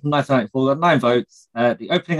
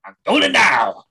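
excited movie quote; 8 semitones higher, 4 dB louder, slower than usual. Retold or imitated. Quoting a film line.

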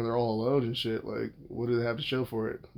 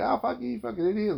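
mock angry jab, pitched high; mock insult; 9 semitones higher than usual. Mimicking someone cursing at him in a quarrel, mock anger. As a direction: imitate the other person yelling at him.

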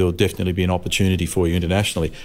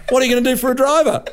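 high, fast, laughing fake concern; [name] angling for the seat, mock innocent and laughing; sly mock innocence; 3 dB louder, much faster than usual. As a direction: ask it innocently while laughing. [name] fishing for the drive.

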